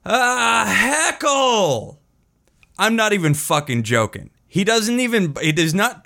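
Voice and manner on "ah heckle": high, drawn-out heckle cry; retold heckler. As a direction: call it out in a long high mock shout. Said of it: "just starts going".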